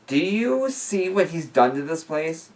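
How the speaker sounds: angry. A narrator's reading. Liam scolding Niall over the wrecked place, angry. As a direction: rage at the ruined house. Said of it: "I asked angrily".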